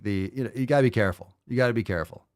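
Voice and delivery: ordinary talk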